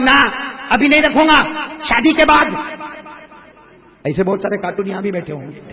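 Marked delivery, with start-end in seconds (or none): 0.0-2.7 put-on young man refusing.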